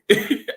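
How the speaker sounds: quick bright laugh at his own joke; a real amused chuckle; short laugh. Real, not acted. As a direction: burst out laughing.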